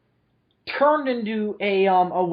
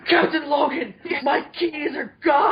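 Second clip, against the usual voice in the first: mock panicked shout, pitched high; Ian; 8 semitones higher, slower than usual. Friend imitating Ian over lost keys, panicked shout. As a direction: wail it out in exaggerated alarm. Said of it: "Ian panicking is funny".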